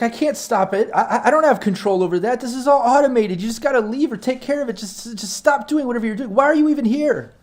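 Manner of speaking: high, rushed, desperate pleading; frightened pleading. Acted. Terrified med tech begging the intruders to leave. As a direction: beg them to stop, voice high with fear.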